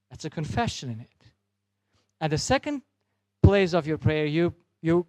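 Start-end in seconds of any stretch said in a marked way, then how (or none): none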